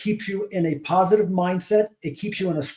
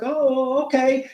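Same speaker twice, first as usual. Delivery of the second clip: higher, startled little "oh, okay"; surprised mother-in-law; 7 semitones higher, much slower than usual. Retold or imitated. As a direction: play the mother-in-law caught off guard. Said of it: "she was like".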